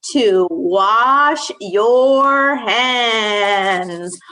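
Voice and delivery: each word drawn out slowly; very slow emphasis. Shown as a demonstration. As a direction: stretch it out like a lesson. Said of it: "teaching the rule".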